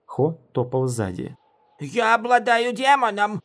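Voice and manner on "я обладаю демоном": high, put-on indignant character voice; wounded boast, pitched way up; offended. A narrator's reading. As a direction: protest in a hurt, high-pitched voice. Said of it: "сказал Ву обиженным тоном".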